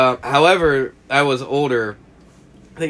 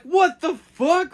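high, slow retold outrage; mad friends; slower than usual. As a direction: throw it back like your worried friends did. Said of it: "they were so mad".

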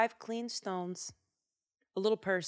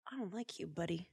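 soft threat; 2 semitones lower, 10 dB quieter, faster than usual. Acted. [name] voiced, soft threat.

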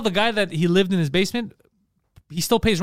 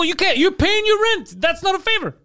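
retold exasperated yelling; 10 semitones higher than usual. Telling a friend that rent is no favor, exasperated. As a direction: hammer it out high, as if out of patience. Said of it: "Last time I'm yelling".

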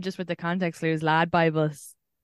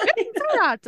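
high-pitched imitation of an Irish accent; mock Irish accent parroted back. American mimicking her Irish accent back at her, high mock Irish. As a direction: echo it back in a high, overdone Irish lilt. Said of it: "And they're like".

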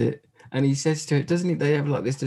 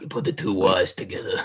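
lower, mock New York accent; 6 semitones lower than usual. Mocking the Canadian tunneler's line in a New York accent. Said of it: "in his New York accent".

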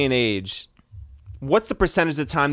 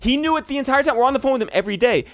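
exasperated; 10 semitones higher, 4 dB louder, much faster than usual. Real, not acted. Exasperated retelling of missed meetup.

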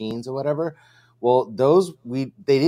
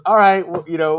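higher, louder flustered mock reply; owner caving in; 5 semitones higher, 9 dB louder than usual. Restaurant owner won over by the pitch, loud flustered mock reply. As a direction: give in, caught off guard. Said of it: "he goes".

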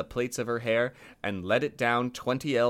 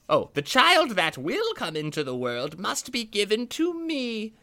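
witch voice; 7 semitones higher than usual. Acted. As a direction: croon it like a scheming witch. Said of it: "The witch said".

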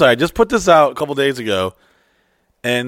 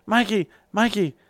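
high, sing-song call of a name; imitating AK calling; 9 semitones higher than usual. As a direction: call him sweetly from the doorway. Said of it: "She's like".

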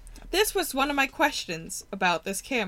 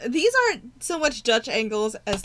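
ordinary talk, nothing marked

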